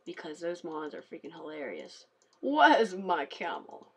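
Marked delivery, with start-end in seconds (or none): 2.4-3.9 slow, louder whiny mob line; lightly voiced game mob asking for its camel; mock mob voice. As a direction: drag it out like a lost nomad mob. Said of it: "Which I just said".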